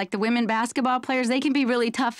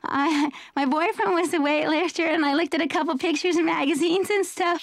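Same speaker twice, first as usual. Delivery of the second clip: higher, giggly airhead answer; woman answering the weightlifter guy, in a high ditzy voice; ditzy girl; 5 semitones higher than usual. Retold or imitated. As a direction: play the ditzy girl fishing for a gift. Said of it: "you're gonna go".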